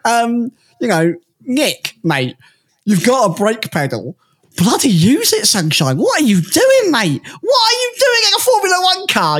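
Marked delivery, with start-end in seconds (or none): 4.6-9.4 higher, shouted what are you doing; mock angry rant. Yelling at Nick De Vries over his crash, mock furious. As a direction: rant at him in mock outrage.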